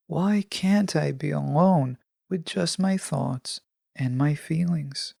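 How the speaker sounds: normal talking voice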